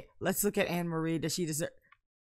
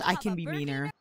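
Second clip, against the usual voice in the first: usual speaking voice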